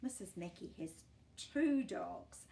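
plain reading voice

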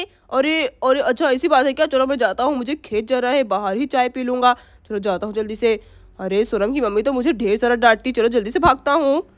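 low rushed father. Acted. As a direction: play the dad slipping away. Father voiced by same speaker.

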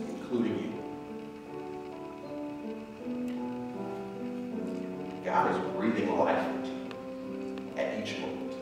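slow, soft meditation voice; guided meditation. Acted. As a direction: guide them into stillness, slowly.

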